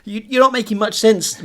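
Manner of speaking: ordinary talk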